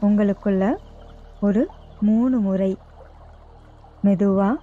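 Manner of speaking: slow, even yoga nidra guidance with long pauses; guided meditation. Real, not acted. Yoga teacher guiding listeners lying down to relax each body part, guided meditation. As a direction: lead them unhurriedly into rest, phrase by phrase.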